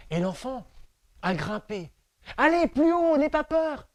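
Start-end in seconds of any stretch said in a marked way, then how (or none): 2.4-3.9 higher, bright coaxing call up the branches; tree cheering the child on, high voice; encouraging high tree voice. Acted. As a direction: urge the child to climb higher, no fear.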